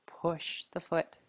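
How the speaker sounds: soft whispered instruction, barely voiced; whispered cue. Real, not acted. Whispered cue during yoga pose.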